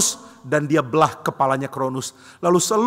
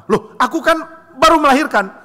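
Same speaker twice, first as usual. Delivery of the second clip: insistent wife; 9 semitones higher, 4 dB louder than usual. Acted. Wife demands her newborn.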